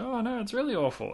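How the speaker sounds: high-pitched mock dismay; retold gutted dismay. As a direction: imitate someone gutted, voice lifted high. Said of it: "he was gutted".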